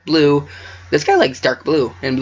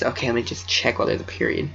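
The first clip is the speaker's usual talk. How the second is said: whisper; 3 semitones lower, 7 dB quieter, faster than usual. Real, not acted. Aside while checking the time.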